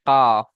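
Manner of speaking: কাফ said short and loud, tongue a bit further forward; kaf demo. Shown as a demonstration. Teacher demonstrating kaf's articulation point, loud crisp demo. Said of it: "লাগিয়ে উচ্চারিত হয়".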